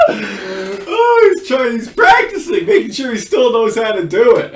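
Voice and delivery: high, loud, helpless laughing speech; real laughing fit; talking through laughter. Real, not acted. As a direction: squeeze the words out while cracking up.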